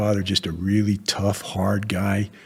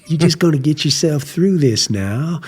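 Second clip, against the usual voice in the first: high-pitched Southern pep talk; Southern accent; 9 semitones higher, 4 dB louder than usual. Retold or imitated. Marine veteran encouraging a struggling new officer, Southern accent. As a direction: imitate a Southern Marine urging a friend on. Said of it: "really Southern accent".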